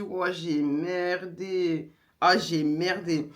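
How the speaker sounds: slow, drawn-out realisation; dazed dread. Retold or imitated. Woman realising labour has started, slow stunned groan of words. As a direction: let it sink in slowly, dismayed.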